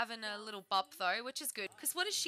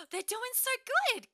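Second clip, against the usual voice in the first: delighted coo; 11 semitones higher, 3 dB louder than usual. Real, not acted. Delighted at babies.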